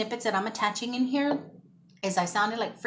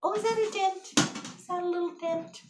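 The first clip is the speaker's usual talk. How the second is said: high-pitched worried questioning; dismayed discovery; 9 semitones higher than usual. Real, not acted. Vlogger finding a dent in her bread box, dismayed. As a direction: spot a flaw and squeak with concern.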